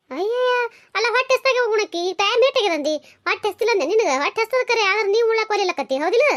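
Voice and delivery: high-pitched motherly protest; exasperated scolding. Acted. Mother to daughter who refuses food, exasperated scolding.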